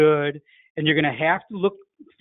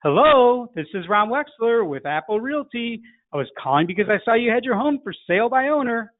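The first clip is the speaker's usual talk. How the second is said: mirroring a high greeting; 6 semitones higher than usual. Shown as a demonstration. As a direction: copy the prospect's lifted "Hello" and keep that pitch. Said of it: "in your closest imitation of their way of speaking".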